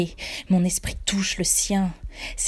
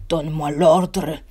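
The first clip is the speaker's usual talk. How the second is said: golem voice; 2 semitones lower, 6 dB louder, slower than usual. A narrator's reading. Golem Hicham demanding the order while fighting. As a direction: demand it slowly. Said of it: "continue Hicham".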